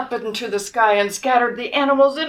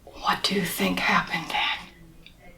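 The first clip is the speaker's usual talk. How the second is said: put-on hushed question to the kids; whispered question; 4 semitones lower, 8 dB quieter than usual. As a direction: whisper it to build suspense. Whispered aside to child listeners.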